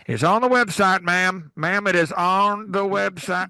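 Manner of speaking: curt, slowed-down brush-off in a put-on voice; a hint of a testy old man repeating himself to a caller; mock belligerent dad. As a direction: imitate the dad snapping at a caller, lightly. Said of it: "belligerently angry with people".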